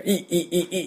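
high clipped grunt cries; Shocker grunt cry. Retold or imitated. Imitating Shocker henchmen charging Kamen Rider. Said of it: "ショッカーの戦闘員が".

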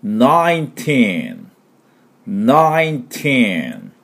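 put-on "nineteen" slow, hummed first N and long falling TEEN; long N, stretched TEEN. Shown as a demonstration. Teacher modelling nineteen for learners, sticky N, long TEEN. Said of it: "これですね".

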